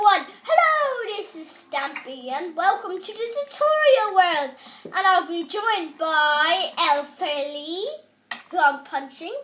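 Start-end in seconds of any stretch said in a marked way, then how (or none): 0.4-7.8 Stampy impression. Player welcomes viewers to the tutorial world, impression of a host. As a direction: present the show like a cheery YouTuber. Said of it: "here's my best impression of Stampy".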